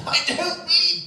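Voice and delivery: very high, loud catchphrase impression; exasperated cry of comic disbelief; Victor Meldrew. Fan shouting a sitcom catchphrase at its star, mock outraged. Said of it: "If you see Victor Meldrew".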